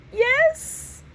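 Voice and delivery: very high cracking yes; voice breaking. Acted. Jack admitting to Dad, voice breaking. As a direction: let the voice break. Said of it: "My voice breaks".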